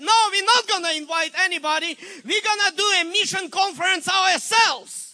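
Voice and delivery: loud, fast, decisive; preacher telling his church to fund missions themselves, loud and fast; emphatic refusal. Retold or imitated. Pastor rejecting invitation idea.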